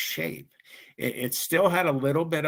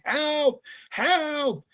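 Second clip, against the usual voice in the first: retold scream for help. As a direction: yell it like a panicked kid. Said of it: "and he's screaming".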